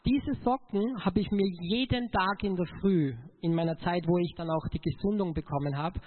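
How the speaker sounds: ordinary talk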